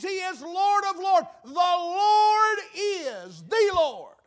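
rising preacher crescendo; preaching climax. Real, not acted.

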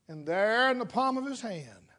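ordinary talk

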